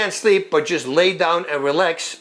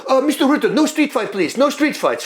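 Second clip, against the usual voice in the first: much higher voice pleading no street fight; imitating the referee; 9 semitones higher than usual. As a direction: pitch it way up like the nervous referee. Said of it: "he says".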